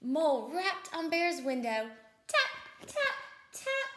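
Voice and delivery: loud narration with high, pointed taps; children's storytime sound effects; animated story read-aloud. A narrator's reading. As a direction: make the taps small sharp knocks. Reads knocking in picture book.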